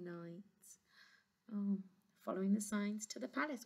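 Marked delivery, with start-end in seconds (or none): none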